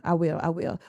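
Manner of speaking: normal talking voice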